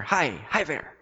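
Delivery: put-on excited baby hello. A narrator's reading. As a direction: chirp back like a giddy baby. Baby answers the old man.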